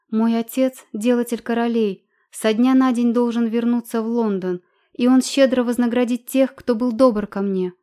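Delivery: appeal. Acted. Anna appeals to the crowd.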